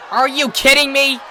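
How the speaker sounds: high, loud indignant tomboy pegasus; outraged Rainbow. Acted. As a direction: cry out in disbelief. Rainbow's outburst of disappointment.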